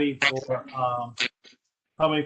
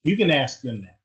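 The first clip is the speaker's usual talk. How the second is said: louder repeat; 5 dB louder than usual. Real, not acted.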